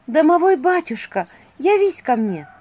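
high, loud summons. A narrator's reading. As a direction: call out to an unseen house spirit. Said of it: "чуть повысив голос".

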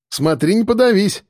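smirking. A narrator's reading. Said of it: "ухмыльнулся Гера".

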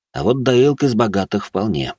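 ordinary delivery

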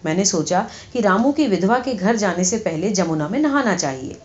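ordinary delivery

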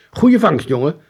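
high, loud fatherly praise; proud father. Acted. Father praises his son's catch, proud and loud. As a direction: clap him on the back with your voice. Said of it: "zei vader".

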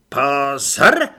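very loud drill cry, rising at the end; brisk, with feeling. A narrator's reading. Corporal repeating the order to recruits, with feeling. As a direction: belt out the command with feeling. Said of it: "teď svižně a s citem".

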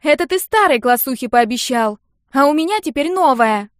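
put-on high, loud girl's protest; whiny, insistent shout; indignant shouting. A narrator's reading. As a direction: argue loudly through a door. Sister yells through the door.

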